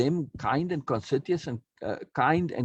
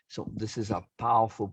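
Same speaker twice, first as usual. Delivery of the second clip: usual speaking voice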